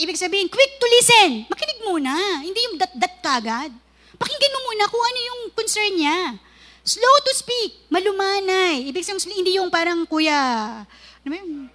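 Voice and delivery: normal talking voice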